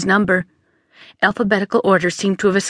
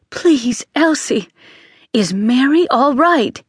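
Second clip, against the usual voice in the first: anxious plea; 5 semitones higher, slower than usual. A narrator's reading. Anxious sister pleads for news.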